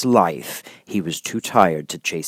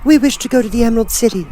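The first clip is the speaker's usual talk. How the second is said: put-on high, fast eager girl; young girl voice; 5 dB louder, faster than usual. A narrator's reading. Girl asking the monkey king, high eager voice. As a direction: rush it out as the little girl.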